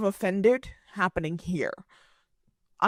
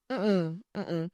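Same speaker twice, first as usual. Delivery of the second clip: usual speaking voice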